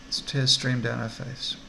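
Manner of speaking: ordinary talk, nothing marked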